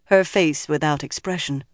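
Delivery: usual speaking voice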